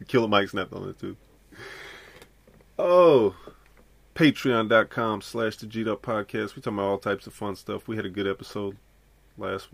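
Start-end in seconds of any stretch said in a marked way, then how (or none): 2.8-3.3 loud exclamation. Real, not acted.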